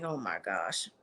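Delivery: a deadpan, disbelieving groan of a line; low muttered oh my gosh; deep, quiet "oh my gosh". Retold or imitated. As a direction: drop way down low and quiet, exasperated. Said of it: "I'm like".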